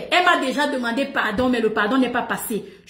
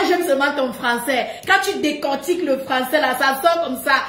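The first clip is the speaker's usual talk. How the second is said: ordinary delivery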